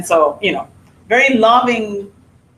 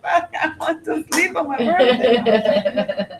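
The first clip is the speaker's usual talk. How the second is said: high, slow whine through laughter; mock whining; 6 semitones higher, slower than usual. Wife imitates husband wanting birthday sleep, mock whining. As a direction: whine it, drawn out, half laughing.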